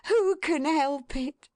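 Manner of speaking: high, slow, fond wife's cry; glad, affectionate woman; Missus Tetterby. A narrator's reading. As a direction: sing it out with motherly delight. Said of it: "cried Missus Tetterby".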